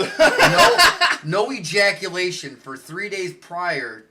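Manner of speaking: slow, cracking up as he repeats her words; laughing through it. Retold or imitated. Retelling the nurse's warning to his co-host, laughing hard. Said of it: "she says".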